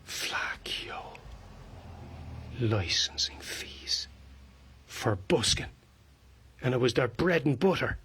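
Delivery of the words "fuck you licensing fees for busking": hushed, unvoiced whisper under the breath; whispered contempt. Busker curses licensing fees, in a contemptuous whisper. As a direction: whisper it with quiet scorn.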